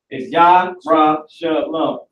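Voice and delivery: "Yah, Ra, Shalom" slowly, YAH, RAH, sha, lum; syllable breakdown. Shown as a demonstration. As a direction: pace out "Yah, Ra, Shalom" with no throaty sounds. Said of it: "We don't do none of that".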